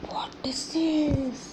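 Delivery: whispered mystery question; whispered riddle to the children. Acted. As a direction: hush it like a secret. Teacher builds suspense for snake.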